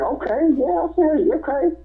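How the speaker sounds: high, hesitant agreeing; nervous rookie. Retold or imitated. Young rookie agrees to the hold, high nervous voice. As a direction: go along uneasily, voice up high.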